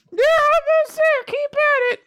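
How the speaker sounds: loud, piercingly high falsetto; squeaky voice. Acted. Squeaky bystander urging someone on, high falsetto. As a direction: chirp it in a tiny put-on squeak. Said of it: "squeaky voice".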